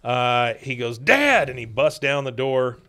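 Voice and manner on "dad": high, loud cry for dad; alarmed retold shout. Son busting down the door, alarmed shout. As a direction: imitate a panicked son calling out.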